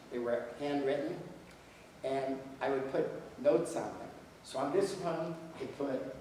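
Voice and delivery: usual speaking voice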